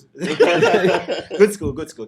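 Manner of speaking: laughing. Real, not acted. Laughing at being called uptown.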